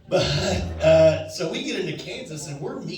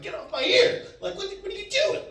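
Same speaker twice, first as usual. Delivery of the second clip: high, fast exasperated brush-off; annoyed. Retold or imitated. Telling his friend to back off, exasperated and high. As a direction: shoo him away, irritated and quick.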